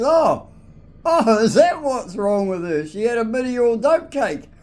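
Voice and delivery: high, loud dawning surprise; surprised realisation. Retold or imitated. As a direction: land on the penny dropping. Landlord realising cat ate dope.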